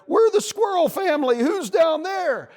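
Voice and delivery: high, loud squirrel holler; calling down. A narrator's reading. Squirrel family answers the bunny below, hollering. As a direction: shout it from high up, stretching the end.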